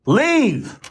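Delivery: high, drawn-out mock scream; high imitation yell; retold scream. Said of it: "She's screaming".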